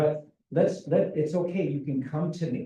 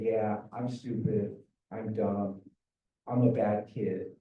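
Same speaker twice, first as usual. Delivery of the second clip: put-on dejected child; 6 semitones lower, 3 dB quieter than usual. A child voiced to parents, calling themself stupid, lower and softer. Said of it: "they'll attack themselves and they'll say".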